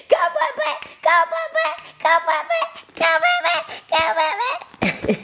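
high sing-song go Papa; sing-song cheer. Real, not acted. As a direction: cheer her on in squeals. Cheering baby.

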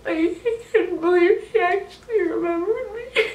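real high-pitched voice breaking with happy tears; tearfully overwhelmed. Real, not acted. Fan telling how her idol remembered her, crying. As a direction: choke up in disbelief, voice climbing high. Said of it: "I might cry".

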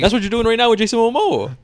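high-pitched, insistent call-out; heated accusation. Real, not acted. Accuses friend in playful argument.

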